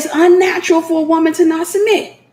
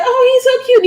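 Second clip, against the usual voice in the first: cooing visitor; 11 semitones higher than usual. Retold or imitated. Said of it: "They be like".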